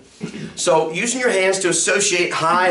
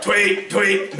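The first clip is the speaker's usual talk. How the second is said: husky bird imitation. Shown as a demonstration. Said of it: "cigar lounge".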